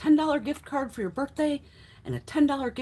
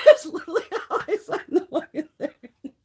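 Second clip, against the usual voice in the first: higher voice breaking into real laughter; laughing; 6 semitones higher, 3 dB louder than usual. Real, not acted. Storyteller recalls signing her write-up, laughing hard. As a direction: crack up while saying it.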